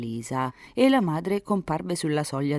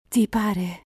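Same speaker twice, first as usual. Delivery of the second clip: higher, lightly exclaimed with a smile; smiling, dignified little question; smiling dignity; 7 semitones higher, 3 dB louder than usual. A narrator's reading. Girl answering Enrico at the windowsill, smiling dignity. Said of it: "sclamò sorridendo la fanciulla con molta dignità".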